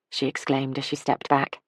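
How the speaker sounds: ordinary delivery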